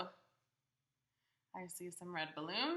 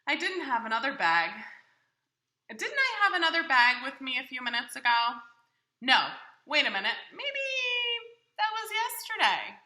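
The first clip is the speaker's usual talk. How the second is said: higher, louder, cheerful Big Bird puzzling over his bag; 5 semitones higher, 12 dB louder, faster than usual. Acted. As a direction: play a big friendly bird talking to the child. Reader voicing Big Bird's lines.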